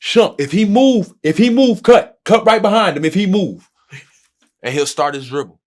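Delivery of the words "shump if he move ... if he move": imitating a loud, higher-pitched call to a teammate; calling out on court. LeBron calling to a teammate in the post, loud and urgent. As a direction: bark the play out across the court. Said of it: "he'll be like".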